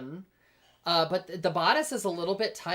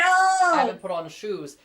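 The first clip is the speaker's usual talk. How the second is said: loud and high, thrilled; excited shout; 7 semitones higher, 10 dB louder than usual. Real, not acted.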